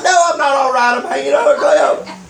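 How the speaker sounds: high-pitched mock exasperation; indignant retort. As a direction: answer a silly question with comic outrage. Acted indignant retort from cliff.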